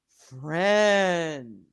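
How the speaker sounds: lightly voiced slow, low Ludo from Labyrinth; a hint of the gentle giant monster, drawn out; Ludo impression. Friend greets the dad in a slow monster voice. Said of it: "Like Odo from, from, uh, Labyrinth".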